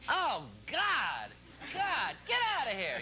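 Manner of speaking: high, swooping cries of alarm; horrified sketch outcry. Acted. Blind guy shoving away a would-be seducer in bed, horrified outcry. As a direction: recoil and yell it out, hammed-up. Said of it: "Then you hear me say".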